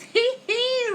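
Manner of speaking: loud, high hee-hee giggle. A narrator's reading. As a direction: giggle high like a child at play. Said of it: "laughs Annie Hibiscus".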